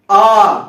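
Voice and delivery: आ held long and loud. Shown as a demonstration. Teacher showing a long vowel: आ drawn out loud. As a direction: stretch आ out, twice as long. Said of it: "देखिए ज्यादा समय लग रहा है".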